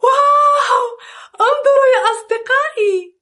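high, fast, excited call; excited wonder. Acted. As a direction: gasp it out in amazement. Sees a spaceship, calls children.

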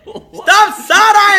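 high, loud, drawn-out holler; stretched-out shouted order; shouted call-out. Real, not acted. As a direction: yell it slowly at the top of your voice. Calling out friend's side-eye.

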